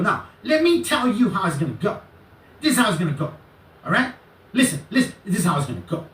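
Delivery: high, agitated, overbearing imitation; mimicking his ranting. Imitating the man telling the lawyer how it's gonna go, high ranting. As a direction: lay down the law in a high mock rant. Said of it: "He went like that, right?".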